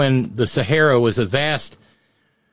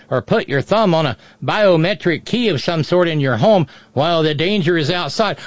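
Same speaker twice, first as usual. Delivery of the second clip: usual speaking voice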